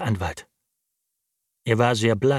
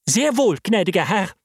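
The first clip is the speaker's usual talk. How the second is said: hint of triumph; 9 semitones higher, 3 dB louder than usual. A narrator's reading. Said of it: "mit einem Anflug von Triumph in der Stimme".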